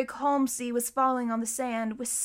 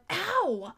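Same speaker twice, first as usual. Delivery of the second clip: high-pitched "ow"; startled, drawn-out ow; sudden pained yelp; 6 semitones higher than usual. A narrator's reading. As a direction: cry out as if suddenly pinched. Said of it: "made a noise like".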